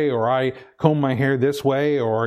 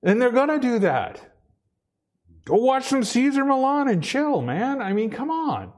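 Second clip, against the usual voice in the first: worked-up rant; 11 semitones higher than usual. Real, not acted. Excited rant continues.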